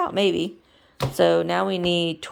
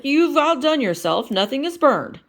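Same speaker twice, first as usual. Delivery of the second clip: Kermit voice; 8 semitones higher, 7 dB louder than usual. Acted.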